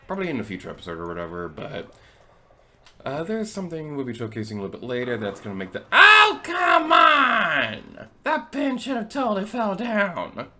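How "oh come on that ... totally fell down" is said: high loud exasperated yelling; frustrated shout. Real, not acted. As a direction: rant at the stubborn pin. Pin won't fall, frustrated outburst.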